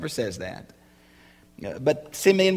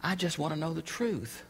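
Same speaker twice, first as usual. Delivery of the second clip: quiet prayer; 2 semitones higher, 8 dB quieter than usual. Retold or imitated. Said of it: "I can remember saying".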